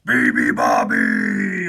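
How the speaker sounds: loud, slow roared bird call; big drawn-out bellow; lion's roar. A narrator's reading. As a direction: roar it out like a lion. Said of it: "roared the lion".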